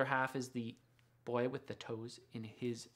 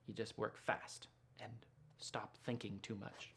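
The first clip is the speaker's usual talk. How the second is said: breathy whisper of advice; whispered tip; 5 semitones lower, 11 dB quieter than usual. Real, not acted. Artist confiding a drawing tip to viewers, in a whisper. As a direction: let it out as a secret.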